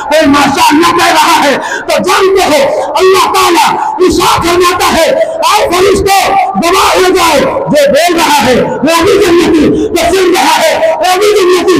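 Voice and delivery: high, strained, impassioned sermon cry; full-force preaching. Acted. Preacher urging devotees to listen to Allah's word, full-force preaching.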